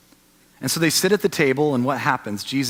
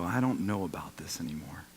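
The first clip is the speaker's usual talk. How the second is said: whispered, lost, drifting off; doubtful whisper; 8 semitones lower, 12 dB quieter than usual. Retold or imitated. A shaken believer whispers doubts to himself. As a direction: breathe the doubt out, barely voiced.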